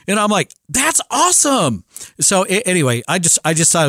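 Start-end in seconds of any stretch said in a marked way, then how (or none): 0.7-1.8 higher, slow, drawn-out awesome; impressed reply. Retold or imitated. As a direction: stretch it out impressed. Dad's reply voiced.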